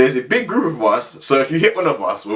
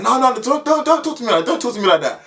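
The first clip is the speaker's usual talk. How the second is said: high, indignant friend arguing back; defiant protest; 11 semitones higher than usual. Retold or imitated. Lewis arguing back at the caretaker, high indignant protest. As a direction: talk back like a cheeky teen.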